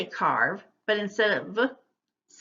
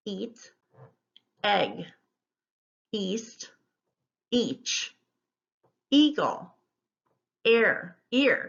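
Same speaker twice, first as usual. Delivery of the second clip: single words with a pause after each; spaced-out teacher's word list; careful word modelling; slower than usual. Shown as a demonstration. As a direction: read the list slowly, word by word. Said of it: "I'll go through it once".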